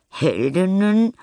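high, slow scornful scoff. Acted. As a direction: scoff at the very idea. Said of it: "Nelly grunzt verächtlich".

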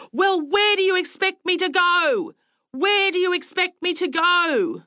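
high-pitched outburst, temper lost; exasperated shouting. A narrator's reading. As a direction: shout it high, insisting on an answer. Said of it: "sat in my car and shouted".

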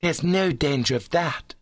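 high, lofty ladylike scorn; understated scorn from a high-minded girl; scornful. A narrator's reading. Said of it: "said Adeline scornfully".

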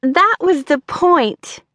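high, slow and pleased with herself; smug. A narrator's reading. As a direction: savour the prank. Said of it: "Brooke's laughter tapered off".

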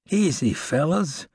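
slow, put-on frailty; feigned weak voice. Outlaw faking weakness to his captors, slow and feeble. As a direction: act hurt to seem harmless. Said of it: "adding a weakened sound to his voice".

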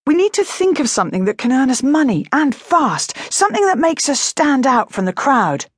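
put-on a girl pushing her idea to the family, bright and eager; eager young girl.

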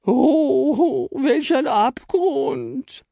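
very high, very slow tearful wail; wailing lament. Acted. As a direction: moan it like a tragedy. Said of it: "jammerte Frau Stern".

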